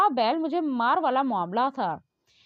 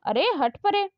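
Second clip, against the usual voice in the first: scolding mother; 6 semitones higher than usual. A narrator's reading. Mother scolding her son.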